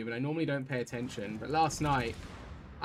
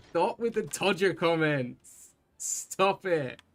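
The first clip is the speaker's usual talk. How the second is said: playful protest; 4 semitones higher, 5 dB louder, much slower than usual. Real, not acted. Protesting chat comments, laughing.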